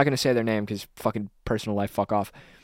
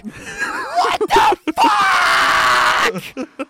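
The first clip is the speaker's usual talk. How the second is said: high, drawn-out furious scream; mad screaming child; 6 dB louder, much slower than usual. Retold or imitated. Furious five-year-old whose cherries vanished, screaming. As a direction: scream each word slowly, way up high. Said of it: "Like, so mad".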